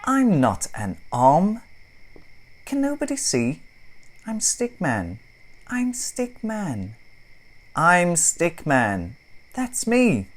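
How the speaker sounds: high, loud protesting stick voice; indignant Stickman. Acted. Stickman protesting to the boy, high and indignant. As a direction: insist on who you are. Stickman insists on his name.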